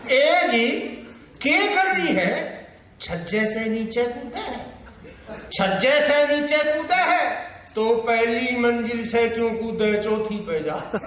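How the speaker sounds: high, slow deadpan comic poet; drawn-out comic four-liner recital; Surendra Sharma. Acted. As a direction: stretch out each line in rustic dialect. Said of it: "Surendra Sharma चार लाइनों में क्या कहता है".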